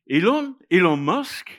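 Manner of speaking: high, loud, delighted clown voice; clown's mishearing. Retold or imitated. Clown to the girl, a high, loud mock-surprised cry. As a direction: squeal it out like a clown mishearing a name. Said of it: "and the clown goes".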